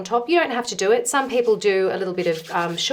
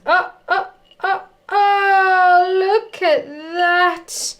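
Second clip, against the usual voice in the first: excited; 8 semitones higher, 5 dB louder, much slower than usual. Real, not acted. Scone tears perfectly.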